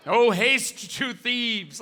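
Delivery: slow, grand, laughing declamation; mock epic poet. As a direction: recite like a hammy bard.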